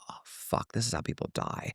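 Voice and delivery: whispered, voiceless aside; hushed dread. Retold or imitated. Walking past two staring tattooed dudes, hushed dread. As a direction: whisper it under your breath in dread. Dread as men stare silently.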